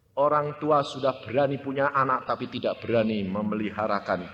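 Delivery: ordinary talk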